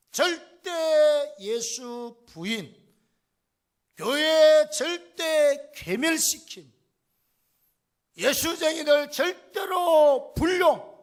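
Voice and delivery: slow, loud, hammered-out declarations; preacher thundering Saul's vows; forceful proclamation. Acted. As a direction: pound out each vow like a decree. Saul's hatred, preached at full force.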